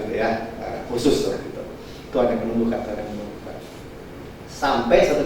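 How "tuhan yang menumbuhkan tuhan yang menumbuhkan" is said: low, soft, fading prayer; quiet repetition. Shown as a demonstration. Preacher repeating the phrase softly, fading away. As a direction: murmur it softly to yourself.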